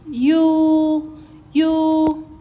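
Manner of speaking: "you" stretched on one high, even note; flat tone, prolonged. Shown as a demonstration. Teacher showing how long to hold it, flat tone. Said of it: "You need to prolong it a little bit".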